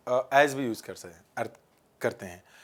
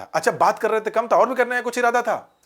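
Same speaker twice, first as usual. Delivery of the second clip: higher, louder mock jealous jab; jealous heroine's retort; 8 semitones higher, 6 dB louder than usual. Heroine snapping at the hero over another girl, higher and louder. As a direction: snap back at the boyfriend in mock jealousy.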